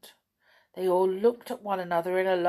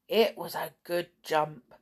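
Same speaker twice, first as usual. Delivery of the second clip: usual speaking voice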